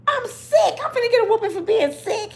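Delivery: loud, high-pitched wail; mock child pleading. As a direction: whine in hammed-up dread of punishment. Child pleads before a whooping.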